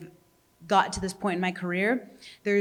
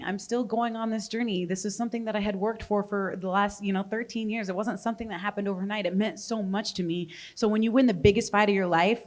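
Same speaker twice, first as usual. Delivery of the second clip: ordinary delivery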